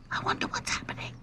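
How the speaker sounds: quick, hushed whisper; all breath, no voice. A narrator's reading. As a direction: whisper it nervously and fast. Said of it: "whispered Lucy".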